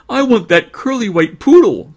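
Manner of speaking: put-on very high, loud little-girl voice; little girl crying out. Small girl picking a dog at the kennel, high loud cry. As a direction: squeal out a child's demand. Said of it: "cried a fat little girl".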